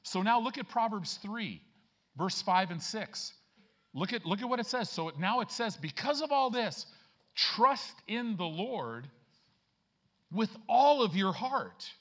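loud, high, stretched-out preaching; full-force preaching. Acted.

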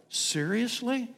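slow exasperated question; exasperated disbelief. Retold or imitated. Storm coming over the mountain.

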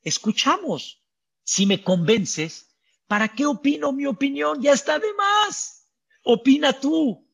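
high, gentle humble reply; lightly voiced sage yielding. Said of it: "Betilel dijo".

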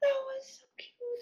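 high soft whisper. Real, not acted. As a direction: whisper it, delighted. Delighted aside.

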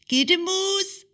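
high, sing-song mother calling out. Acted. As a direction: call her daughter up, high and bright. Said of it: "kaldte hun". (mor kalder højt og syngende på datteren)

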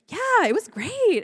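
high, slow, sing-song enthusiasm; oblivious cheer. Retold or imitated. Girlfriend to boyfriend about last night, oblivious cheer. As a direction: gush with swooping pitch.

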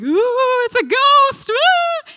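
very high, loud hammed-up ghost wail; playful fake ghost cry; spooky ghost effect. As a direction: ham it up like scared disciples. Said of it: "That was my, um, added effect here".

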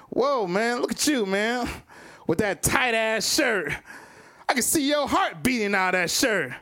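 high-pitched heckling comic impression; imitating a hack comic's crowd roast; bad roast comic. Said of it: "he tried to roast me".

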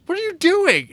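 loud, very high mock shout; mock outburst. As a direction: burst out in exaggerated outrage. Acting out an angry movie outburst.

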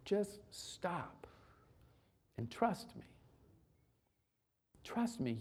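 soft, hushed and unhurried, as God reassuring a weary person; gentle God voice. Acted. God telling a tired listener to rest, in a soft voice. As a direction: lay it down softly, like a promise.

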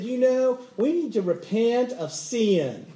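mock Southern preacher, drawn-out vowels; hammed-up Southern drawl on the last words; Southern accent. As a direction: imitate a slow Southern call to repent. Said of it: "the guy had a southern accent".

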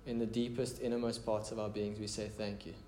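quiet, intimate prayer leading. Real, not acted. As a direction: pray aloud gently and earnestly, voice dropping at phrase ends. Leads the congregation in prayer.

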